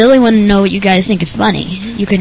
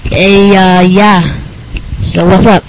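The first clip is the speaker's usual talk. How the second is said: ordinary talk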